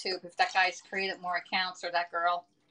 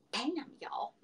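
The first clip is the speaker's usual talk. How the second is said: high, sassy victory call; mock triumphant bam; 7 semitones higher, 7 dB quieter than usual. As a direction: slam it down like a winning point. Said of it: "I'm like".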